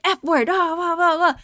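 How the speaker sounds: high, loud censored cursing; mock swearing. Retelling the manager swearing over a missed sauce, mock cursing. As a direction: imitate a cursing rant with filler words. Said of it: "like, swearing".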